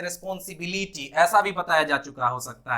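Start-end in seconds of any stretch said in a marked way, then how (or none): 0.0-1.1 रिस्पॉन्सिबिलिटी with a stretched BEE-lee before ti; worse mispronunciation. Shown as a demonstration. Teacher showing how some schools misteach it, mispronounced. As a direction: drag the i vowels in रिस्पॉन्सिबिलिटी. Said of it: "और गलत पढ़ा देते हैं".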